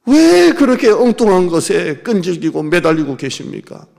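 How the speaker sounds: loud, slow rebuke; fiery preaching. Acted. Pastor challenging the congregation, loud and slow. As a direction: drag out the question loudly.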